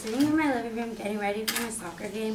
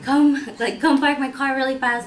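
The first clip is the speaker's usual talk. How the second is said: raised, higher, hurried-sounding order; urgent calling out; 5 semitones higher, 8 dB louder than usual. Retold or imitated. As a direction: re-enact shouting for someone to come. Calling young cousin outside urgently.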